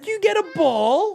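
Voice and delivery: high sing-song question. Real, not acted. Talking to toddler.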